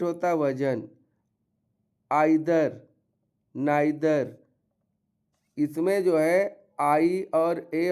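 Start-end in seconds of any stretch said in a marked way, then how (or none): none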